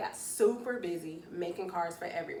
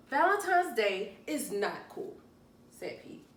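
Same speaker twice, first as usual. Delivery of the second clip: read-aloud story voice; 2 semitones higher, 4 dB louder than usual. Acted. Reading picture book to children.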